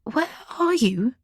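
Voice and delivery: mother anxiously asks her daughter where she is; anxious, curious. A narrator's reading. Mother asks daughter where she is, anxious and curious. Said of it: "with a mix of anxiety and curiosity".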